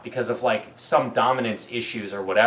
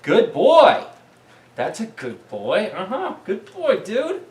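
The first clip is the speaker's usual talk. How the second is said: excited dog praise; 9 semitones higher, 5 dB louder, slower than usual. Real, not acted. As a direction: owner cooing praise at his dog after a retrieve. Praising the dog after retrieve.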